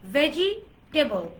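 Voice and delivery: ভেজিটেবল drawn out slowly in two chunks, "ve-ji, te-bol"; slow four-syllable ভেজিটেবল with the middle vowel sounded; common mispronunciation. Shown as a demonstration. Teacher repeating what the class usually says, slow mispronunciation. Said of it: "কি বলি আমরা?".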